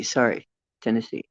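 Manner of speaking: ordinary delivery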